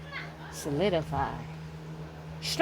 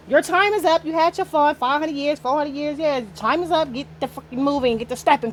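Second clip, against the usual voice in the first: normal talking voice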